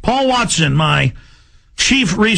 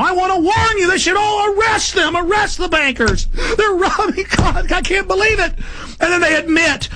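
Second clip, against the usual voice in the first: much higher, shouted rant; furious shout; 11 semitones higher than usual. Real, not acted. Radio host warning listeners, shouting in outrage. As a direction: yell the warning as if the bankers are robbing God.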